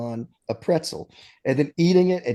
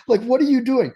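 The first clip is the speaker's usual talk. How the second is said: incredulous; 11 semitones higher, 6 dB louder than usual. Real, not acted. Incredulous at Rumsfeld's antics.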